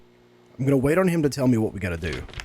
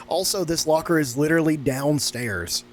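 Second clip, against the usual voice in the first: ordinary talk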